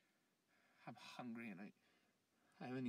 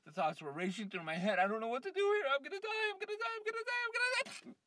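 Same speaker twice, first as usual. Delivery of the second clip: higher and louder, climbing into frantic fear; rising panic; 13 dB louder, faster than usual. Acted. Man in panic convinced he will die, frantic and shrill. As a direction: spiral up into terror, louder each time.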